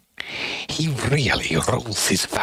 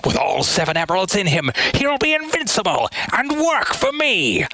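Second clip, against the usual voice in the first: raised, wobbly mad scientist villain voice; Eggman voice; 8 semitones higher than usual. Pretend. Streamer performing a voice request, gloating villain. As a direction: gloat like a scheming evil doctor. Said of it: "so if I can do".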